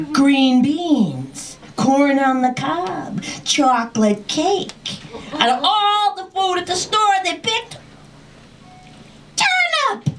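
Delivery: high, theatrical rant climbing to a squeal; ranting conspiracy warning. Retold or imitated. Dragon warning child that parents poison her, high frantic rant. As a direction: build to comic hysteria, stretching key words.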